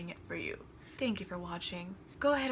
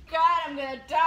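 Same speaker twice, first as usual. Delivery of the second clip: loud dramatic dread; 4 semitones higher, 8 dB louder, faster than usual. Real, not acted. Dreading the spicy noodles.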